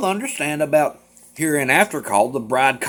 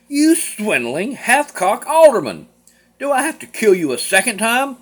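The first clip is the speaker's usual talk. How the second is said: furious outburst; 10 semitones higher, 4 dB louder than usual. Acted. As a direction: spit the insult at him. Nicholas rages at his brother.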